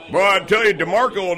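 normal talking voice

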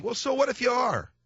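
high, emphatic retort; offhand challenge. Retold or imitated. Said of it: "as opposed to saying".

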